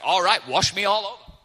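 loud, gung-ho mock enthusiasm; overeager outburst. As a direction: blurt it out, suddenly all in. Peter's overeager turnaround, acted.

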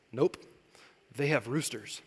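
put-on hushed, certain child voice; little daughter's answer. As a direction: quietly state it like a sure little kid.

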